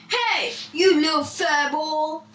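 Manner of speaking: angry yell at a rival. Acted. Jealous bully yelling at the stage. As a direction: call out the jealous insult. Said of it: "he suddenly yelled".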